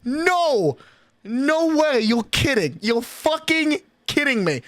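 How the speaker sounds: high-pitched, exasperated yelling. Real, not acted. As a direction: yell it in drawn-out disbelief. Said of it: "I'm yelling at twelve fifty-three".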